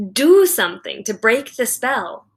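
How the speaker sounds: plain reading voice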